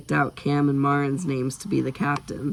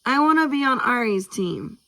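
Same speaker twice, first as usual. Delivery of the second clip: put-on high little-girl voice, insisting; high-pitched childish demand; insistent child; 10 semitones higher than usual. A narrator's reading. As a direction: insist like a small kid. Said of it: "Skylar insisted".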